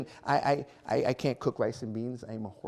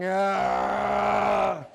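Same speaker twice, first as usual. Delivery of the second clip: mock recoil; 4 semitones higher, 4 dB louder than usual. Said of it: "and I'm like".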